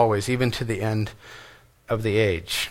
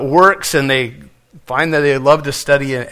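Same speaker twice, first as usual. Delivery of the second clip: usual speaking voice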